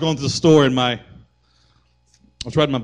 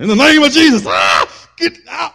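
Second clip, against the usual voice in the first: high, loud shout of rebuke; shouted command in Jesus' name; 14 semitones higher, 12 dB louder than usual. Acted. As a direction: cast it out at the top of your voice. Acted rebuke of a mountain.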